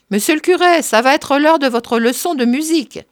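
high, loud call from a balcony. A narrator's reading. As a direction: call out to the priest below, pitched well up. Marthe calls down from balcony.

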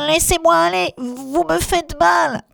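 high, pinched nasal plea; talking through the nose. Acted. Elephant child with nose caught, nasal high plea. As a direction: plead with your nose held tight. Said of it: "dit en parlant du nez".

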